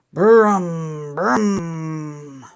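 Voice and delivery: low rolling brum of a truck; engine brum. Pretend. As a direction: rumble it like a toy truck. Toy truck engine noise.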